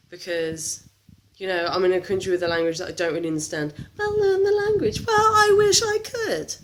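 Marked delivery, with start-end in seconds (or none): 4.0-5.0 high put-on voice of the know-it-alls; mock nagging voice. Voicing people telling her to learn French, high and mocking. 5.1-6.5 high, slow, strained reply; high exasperated whine. Retold or imitated. Answering the critics, high and exasperated.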